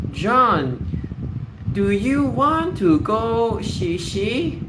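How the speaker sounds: high, very slow mock cooing; cutesy parent baby-talk. Parent asking little John to go, mocking baby-talk. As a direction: imitate talking down to a toddler, drawn out. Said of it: "who talks like this to, to children?".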